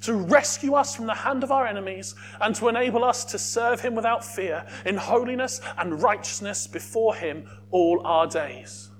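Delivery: ordinary talk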